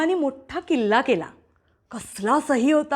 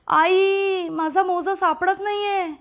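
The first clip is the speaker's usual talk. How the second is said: put-on whiny older sister; 6 semitones higher, slower than usual. Sister calls mother.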